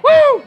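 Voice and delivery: high, loud "woo"; short high cheer; celebratory whoop. Real, not acted. Mock cheer for local Aldi's.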